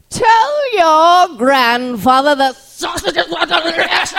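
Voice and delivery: high, loud, slow gushing with a laugh; overdone praise, beaming through it; impression of Kathleen. A co-star gushing to the comedian about the sausages. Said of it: "This is my impression of her".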